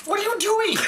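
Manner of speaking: ordinary talk, nothing marked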